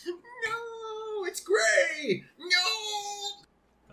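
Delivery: high wailing mock cry; mock anguished wail. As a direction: wail it like heartbroken. Mock anguish over shirt color.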